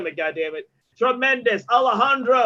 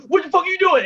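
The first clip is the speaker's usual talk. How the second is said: mock angry wife; 10 semitones higher, 5 dB louder, faster than usual. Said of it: "Laura was like".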